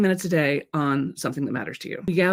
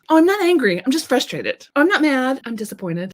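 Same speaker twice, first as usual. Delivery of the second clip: higher-pitched, imitated protest of not being angry; mock denial; 7 semitones higher than usual. Women insisting they are only frustrated, mock denial. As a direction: play a protesting denial, starting high and dropping at the end. Acting women who deny their anger.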